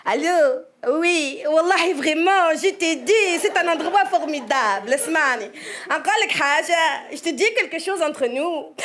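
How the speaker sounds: lilting Tunisian phone chatter; singsong Tunisian accent. Shown as a demonstration. Tunisian woman chatting on the phone, singsong Tunisian accent. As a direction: let each phrase sing in a Tunisian accent. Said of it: "on a tendance à chanter".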